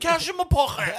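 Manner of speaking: very high, shouted Cockney impression; Cockney kid. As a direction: squawk it as a hammed-up Cockney haggler. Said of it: "this forty-year-old Cockney man haggling this".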